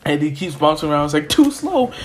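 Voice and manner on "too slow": high-pitched mocking jab; taunt. Gran Torino bouncing around Izuku, taunting. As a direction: taunt him high and sing it out.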